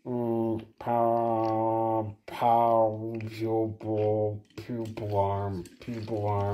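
slow low robot drone. Pretend. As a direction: hum then speak like a machine. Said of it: "why you sound like a robot?".

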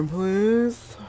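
high, drawn-out pleading please; whiny begging. Acted. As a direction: whine it like a child begging. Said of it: "begging admin for repair".

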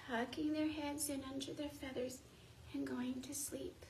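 soft hushed bedtime whisper; sleepy whisper. Acted. Teacher to class about sleeping birds, whispered. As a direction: whisper it like the birds are drifting off.

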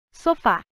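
Sofá with the weight on "fá"; stress on final syllable. Shown as a demonstration. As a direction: say "Sofá", last syllable strongest. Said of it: "A sílaba fa é emitida com maior intensidade".

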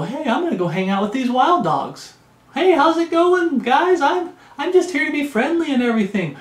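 high-pitched, eager, naively friendly dog voice. Acted. Friendly pet dog running up to wild dogs, high chummy voice. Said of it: "that domesticated dog runs out there to go".

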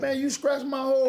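high, indignant complaint; heated complaint. Real, not acted. Bickering over being scratched.

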